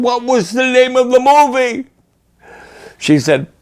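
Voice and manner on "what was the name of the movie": anxious father. Retold or imitated. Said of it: "I said".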